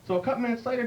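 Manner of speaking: ordinary talk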